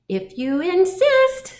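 high, slow, loud reply; high-pitched storybook insect; praying mantis. A narrator's reading. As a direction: draw it out high, obligingly. Mantis answers the challenge.

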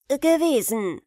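put-on cheeky boy, high and loud. Aladdin answers the stranger cheekily. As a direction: toss it off boldly. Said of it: "sagte Aladdin keck".

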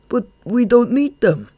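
high, loud objection; pleading protest. A narrator's reading. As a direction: argue anxiously with the boss. Follower protests to his boss.

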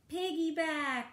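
high-pitched gleeful call; bright idea, sung out high; pigs' eager cry. A narrator's reading. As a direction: pipe it up like excited little pigs. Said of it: "the pigs decide".

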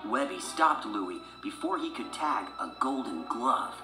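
ordinary delivery, nothing marked

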